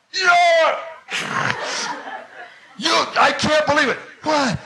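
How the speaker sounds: high-pitched, loud ranting shout; furious yelling. Acted. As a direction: scream at the friend who sent you. Furious man wakes startled friend.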